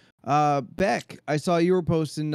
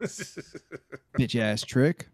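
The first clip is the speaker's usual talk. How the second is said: slow drawn-out insult; much slower than usual. Acted. Playful insult to friend.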